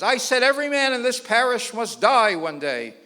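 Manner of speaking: repeating the sermon line, sterner; priest insisting. Retold or imitated. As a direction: hammer it home at the laughing man. Priest repeats louder at man.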